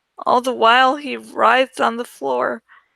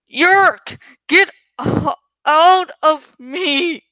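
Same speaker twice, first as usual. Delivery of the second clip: higher, louder anguished cry, slowing at the end; 4 semitones higher, 5 dB louder, slower than usual. A narrator's reading. Possessed teacher crying out at the Yeerk inside him, anguished. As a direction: tear the words out in agony. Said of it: "And he cried".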